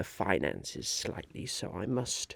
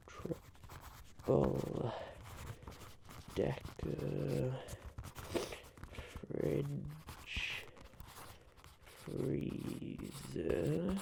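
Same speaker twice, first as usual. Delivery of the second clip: drawn-out words, quiet and a bit higher; murmured dictation; 4 semitones higher, 9 dB quieter, much slower than usual. Acted. The Onceler writing down the fridge idea, slow quiet murmur. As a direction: spell it out under your breath as you write.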